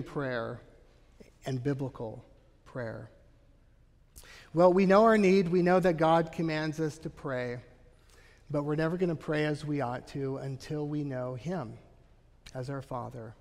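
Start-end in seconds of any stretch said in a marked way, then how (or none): none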